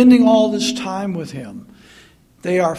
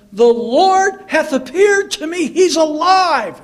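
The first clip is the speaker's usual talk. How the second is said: higher, louder, eager news of the risen Lord; excited announcement; 8 semitones higher, 5 dB louder than usual. Retold or imitated. Peter telling the disciples, excited and loud. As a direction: burst out with the good news. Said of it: "When Simon Peter said to them".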